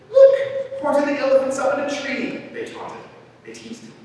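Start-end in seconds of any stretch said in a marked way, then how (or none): 0.1-2.4 high, loud teasing call; gleeful shout. A narrator's reading. Friends spot elephant up a tree, gleeful shouting. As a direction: crow it out with mocking delight. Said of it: "they shouted with glee".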